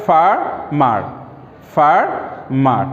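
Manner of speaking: ফার্মার broken slowly into far, mar; split into syllables. Shown as a demonstration. Teacher showing farmer has two syllables, slowly split. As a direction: draw out each half of ফার্মার with a gap. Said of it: "ফার একটা syllable".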